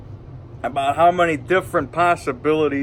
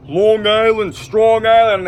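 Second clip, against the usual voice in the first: lightly put-on Jersey and Long Island accent; a hint of New York-area accent, louder; 5 semitones higher, 4 dB louder, slower than usual. Shown as a demonstration. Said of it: "by the time you get over to Jersey".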